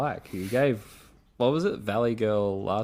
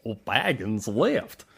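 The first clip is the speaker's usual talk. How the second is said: slow cartoon Goofy drawl; dopey cartoon voice; slower than usual. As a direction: wraith replying as Goofy. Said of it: "have the same accent as Goofy".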